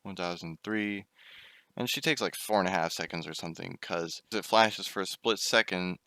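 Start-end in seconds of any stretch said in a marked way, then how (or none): 0.0-1.1 slow, drawn-out counting; mimicking mom's too-slow count. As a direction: count each second lazily slow. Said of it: "she counts seconds way slower than actual seconds".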